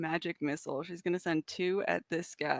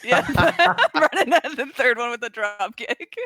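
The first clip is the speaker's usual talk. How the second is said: louder, giggling through the words; laughing; 6 semitones higher, 10 dB louder than usual. Real, not acted. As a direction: say it mid-laugh. Laughing while declaring attack.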